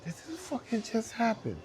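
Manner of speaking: high-pitched imitation of an amazed judge; high, laughing mock astonishment; stunned disbelief. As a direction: squeal it in disbelief, half laughing. Retelling a judge's stunned reaction.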